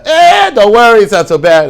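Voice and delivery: high, loud, breezy brush-off; helpline schmoozer. Acted. Helpline man schmoozing with a worried caller, breezy reassurance. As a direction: put on a loud, high voice waving worries away. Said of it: "will schmooze with you on the phone".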